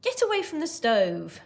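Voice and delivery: high, loud, sharp command; snapping. Acted. Mum warning child away from stove, snapping. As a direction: snap it as an urgent telling-off. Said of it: "snapped Mum".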